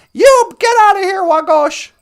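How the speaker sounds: high, loud squawked order; angry chicken. Acted. Chicken ordering fox out of the pen, angry squawk. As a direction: shout it as a furious hen chasing off an intruder. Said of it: "You could hear the chicken saying".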